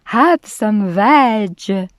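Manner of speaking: slow, loud hooted offer; drawn-out dinosaur hoot. A narrator's reading. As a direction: stretch it out in a hoot. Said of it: "And they hooted".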